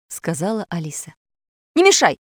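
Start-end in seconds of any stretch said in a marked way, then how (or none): 1.7-2.2 high, loud, annoyed retort; irritated brush-off. A narrator's reading. Impostor Pashka is distracted by the mechanic, irritated brush-off. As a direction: snap at a nagging friend.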